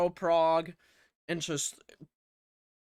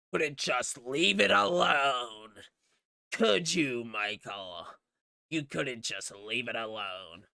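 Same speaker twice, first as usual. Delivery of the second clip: slow, theatrical villain taunt, sinking low; hammed-up villain; 3 semitones lower than usual. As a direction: draw it out with mock menace. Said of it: "He has a villain monologue".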